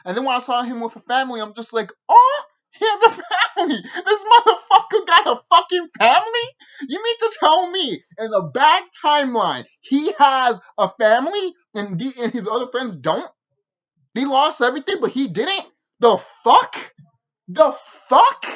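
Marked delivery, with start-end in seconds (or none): 2.1-6.5 high, loud, laughing disbelief; incredulous outburst. Retold or imitated. Viewer reacting to Cartman having a family, in hammed-up disbelief. 6.9-18.5 raised pitch, loud indignant questions; acted incredulity. Viewer protesting that only Cartman has a family, in acted incredulity. Acting out his incredulous reaction.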